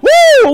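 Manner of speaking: very high, loud "woo"; hammed-up swooning whoop; excited whoop. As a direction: let out a piercing cheer. Whoops over the man described.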